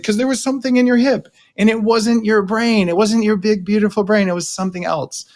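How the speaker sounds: a gently knowing answer pitched high; knowing explanation. Retold or imitated. Coach voices the answer to the crying CEO, high and knowing. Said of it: "It's like".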